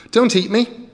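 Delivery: put-on high, loud child's plea; pleading child. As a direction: beg the troll not to eat you.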